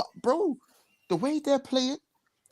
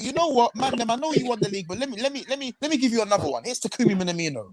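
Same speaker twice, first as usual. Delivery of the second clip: mock club owners; 6 semitones higher, faster than usual. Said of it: "And then they thought".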